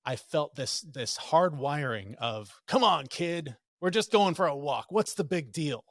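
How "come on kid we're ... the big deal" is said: put-on louder, higher brush-off to a kid; lightly acted impatience, pushing the kid along; dismissive urging. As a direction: chivvy a child as if it's nothing. Voices dismissive inner hard-wiring.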